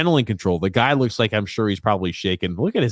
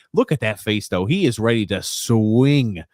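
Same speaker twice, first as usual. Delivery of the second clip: usual speaking voice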